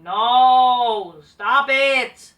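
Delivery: loud, drawn-out no, then stop it; exasperated shout. Real, not acted. Ranting at WWE bookers, a loud exasperated shout. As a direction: yell it in disbelief.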